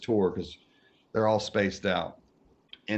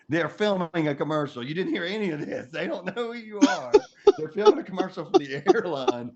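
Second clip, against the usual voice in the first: laughing; 7 semitones higher, 4 dB louder than usual. Real, not acted. Explaining to Steven, cracking up.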